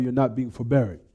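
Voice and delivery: ordinary talk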